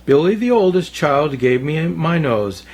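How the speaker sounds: ordinary delivery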